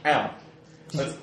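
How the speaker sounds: ordinary talk, nothing marked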